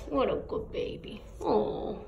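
soft unvoiced whisper. Real, not acted. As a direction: hush it like a lullaby. Whispering to kitten.

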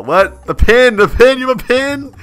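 high, loud excited repetition; startled discovery. Real, not acted. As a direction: blurt it out in disbelief. Sudden discovery of the pin.

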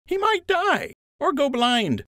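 goofy sidekick quip; cheeky friend. A narrator's reading. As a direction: toss the joke off brightly. Lund voiced by narrator.

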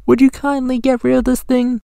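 very high, quick cartoon monkey. A narrator's reading. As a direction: squeak the request fast. Monkeys ask the eagle.

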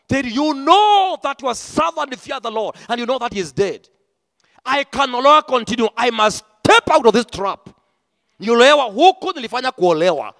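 loud, driving preacher's cry; full-force preaching. Real, not acted. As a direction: preach it out to the whole hall.

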